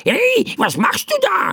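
very high squawking protest; bird calling out. A narrator's reading. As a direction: squawk it like a startled crow. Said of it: "rief der Vogel". (kreischend rufende Vogelstimme)

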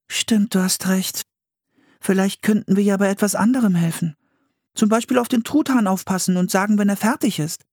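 put-on high-pitched girl's voice, suggesting; helpful Anne. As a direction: offer an idea as the sister. Anne suggests another chore.

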